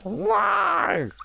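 very high cry of protest; anguished wail. Real, not acted. Player caught in game, anguished wail. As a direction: wail the word in dismay.

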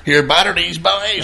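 lightly voiced bold woman; mimicking the girl.

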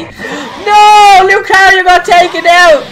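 high, loud wail broken by laughter; laughing outcry. Real, not acted. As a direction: shout in laughing disbelief. His Lucario is knocked out.

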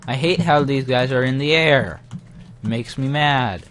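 loud annoyed complaint at the flyers; frustrated rant. Real, not acted. Gamer fed up with flying enemies, loud annoyed grumble. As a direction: vent your irritation loudly.

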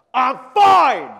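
upset roommate shouting that he is fine; furious shout. Retold or imitated. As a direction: yell it, clearly not fine. Angry roommate denies.

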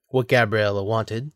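usual speaking voice

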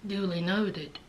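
very soft, low deadpan aside; sarcastic low aside; dry deadpan. Retold or imitated. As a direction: murmur it flat and unimpressed. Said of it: "I was like".

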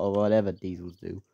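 usual speaking voice

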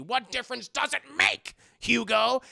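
hammed-up defensive anger; angry outburst. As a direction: act out an irritated snap at the therapist. Bruce snapping at probing therapist.

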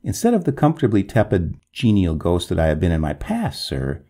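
plain reading voice

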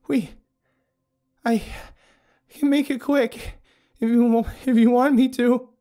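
high, loud, broken-up phrases; halting distressed offer. A narrator's reading. Abigail offers the dying narrator a quick end, halting and distressed. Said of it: "as her voice trailed off".

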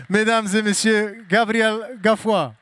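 slow, drawn-out stage announcement; announcer introduction. Real, not acted. Host presenting a guest to ladies and gentlemen, announcing. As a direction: announce the name slowly to the room.

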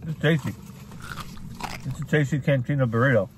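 talking while chewing food; muffled by a bite; mouth full. Real, not acted. Seen 0: Just bit burrito, then speaks with chewing mouth movements.

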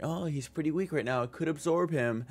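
a sly villain sizing up his prey; scheming villain voice. Retold or imitated. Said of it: "He's like".

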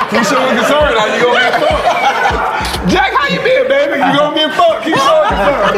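laughing shout. Real, not acted. Laughing, yelling at a friend.

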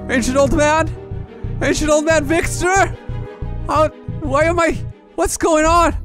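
high, distressed cries for help; panicked calling out. Acted. Hero lost in the sky, panicked calling. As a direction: call up high, bewildered.

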